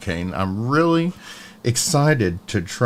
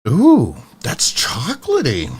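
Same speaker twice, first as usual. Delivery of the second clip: high, swooping pleased "ooh"; delighted surprise; 6 semitones higher, 3 dB louder than usual. Real, not acted. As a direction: react with real, pleasant surprise. First reaction to the sampler.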